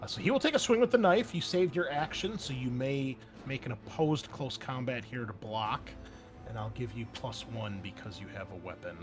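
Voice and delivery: usual speaking voice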